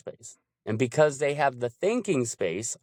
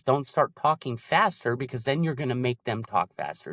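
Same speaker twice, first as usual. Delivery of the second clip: ordinary talk, nothing marked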